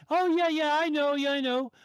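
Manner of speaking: high imitation of a breezy worker; drive-thru worker. Drive-thru worker answering a customer, high mock voice.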